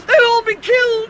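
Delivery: panicked cry. Acted. Bilbo watching the battle go badly, panicked. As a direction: blurt it out in terror.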